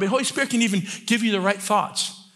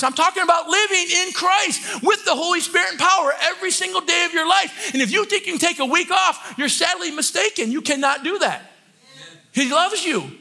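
yelling preacher; 8 semitones higher than usual. Real, not acted. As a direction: yell it at the congregation out of love. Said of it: "I'm yelling at you guys".

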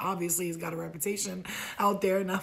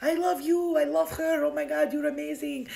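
high, loud gushing cheers; celebrating crowd; 8 semitones higher, 4 dB louder than usual. Retold or imitated. Whole bar celebrates the woman buying drinks, cheering. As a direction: cheer like an adoring drunk crowd. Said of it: "the whole bar celebrated me".

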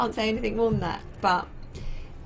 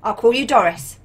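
loud clear command; 7 dB louder than usual. Real, not acted. Voice command to ship AI.

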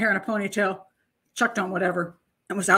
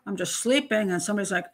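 ordinary delivery

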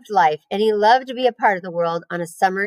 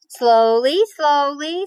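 drawn-out, higher rising call; cautioning; 7 semitones higher, 3 dB louder, much slower than usual. A narrator's reading. Spider checking the pig racing to his trough, cautioning. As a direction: rein someone in with stretched, lilting words.

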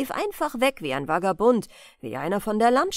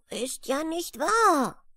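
put-on little boy's higher, drawn-out protest; guileless, anxious; 7 semitones higher, slower than usual. A narrator's reading. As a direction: protest earnestly in a small worried voice. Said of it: "rief da treuherzig und ängstlich zugleich". (treuherzig und ängstlich gerufen)

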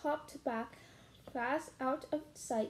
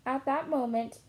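plain reading voice

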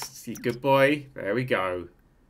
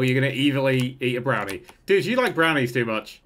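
usual speaking voice

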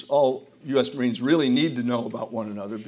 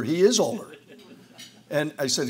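ordinary talk, nothing marked

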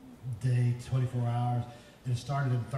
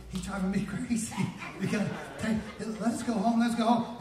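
comic mock pleading, pitched up; begging; 8 semitones higher, 3 dB louder than usual. Preacher voicing his mom begging the doctor, comic pleading. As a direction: plead higher and more urgently. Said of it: "My mom started begging".

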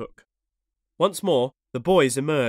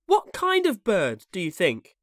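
put-on curious boy; 6 semitones higher, 4 dB louder than usual. A narrator's reading. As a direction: ask eagerly in a boy's voice. Boys question Nibs eagerly.

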